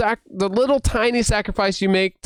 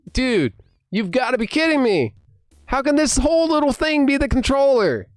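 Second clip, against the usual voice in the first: amazed disbelief; 7 semitones higher than usual. Retold or imitated. Re-enacts amazement at controller.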